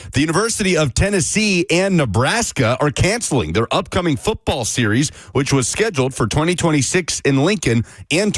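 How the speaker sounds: radio sports update read; news read. Acted.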